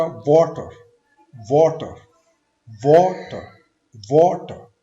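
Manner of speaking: Water loud, weight on वा; loud stress drill. Shown as a demonstration. Teacher repeats water loudly for students to copy. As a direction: call out water loudly, pressing वा. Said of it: "first syllable पे".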